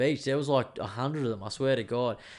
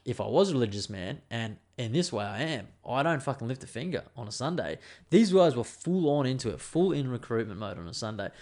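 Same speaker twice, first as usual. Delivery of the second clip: normal talking voice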